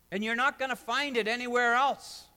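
full-force preaching. Acted. Pastor urging hurting people toward hope, preaching hard. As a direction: preach it out to the whole room.

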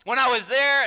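loud, high, impassioned recollection; Paul incredulous. Acted. Preacher imagines Paul addressing the Galatians, loud and incredulous. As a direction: raise the voice as an exasperated apostle. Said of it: "I can imagine he's saying".

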